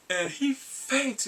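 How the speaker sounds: high, loud, drawn-out exclamation; dramatic shock. Retold or imitated. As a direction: stretch it out, high and emphatic. Dramatic retelling of a faint.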